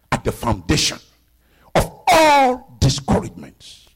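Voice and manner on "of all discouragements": higher, louder, slow build on all; preaching emphasis. Acted. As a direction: hammer the point home. Repeated, now with force.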